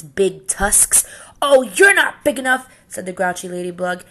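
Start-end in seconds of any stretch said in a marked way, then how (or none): 1.4-2.7 loud, high, dismissive sneer; scornful brush-off. Acted. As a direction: wave him off to save face. Grouchy ladybug backs off.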